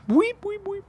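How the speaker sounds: high squeaky guinea pig weeps. Retold or imitated. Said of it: "That's what Madame Bijou would say".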